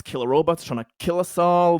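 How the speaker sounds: ordinary delivery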